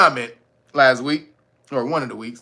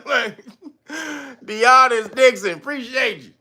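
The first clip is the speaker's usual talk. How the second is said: usual speaking voice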